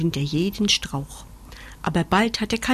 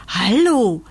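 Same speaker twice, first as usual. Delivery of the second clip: hesitant, louder drawn-out call; anxious; 2 semitones higher, 6 dB louder than usual. A narrator's reading. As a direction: call out with a touch of fear. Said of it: "fragt er ängstlich".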